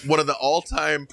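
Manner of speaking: usual speaking voice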